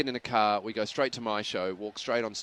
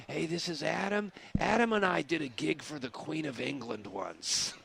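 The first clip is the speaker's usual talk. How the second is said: Whoopi Goldberg; 3 semitones higher, 5 dB quieter than usual. Retold or imitated. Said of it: "She's introducing me to people by going".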